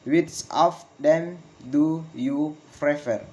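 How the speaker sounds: slow, each word set apart, "prefer" falling; slow falling question. Shown as a demonstration. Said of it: "Failing intonation in with, in questions".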